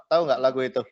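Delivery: usual speaking voice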